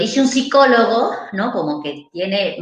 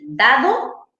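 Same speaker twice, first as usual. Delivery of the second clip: therapist's model word; 4 semitones higher than usual. Retold or imitated. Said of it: "vamos a repetir".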